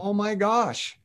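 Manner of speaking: high, breathless "oh my gosh"; awed. Retold or imitated. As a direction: gasp it out in wonder. Awed reaction to God.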